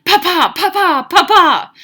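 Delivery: loud, high popping noises in rapid pairs; machine-gun bursts. Acted. Alvin exploding at the party, machine-gun bursts. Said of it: "I sounded like a machine gun".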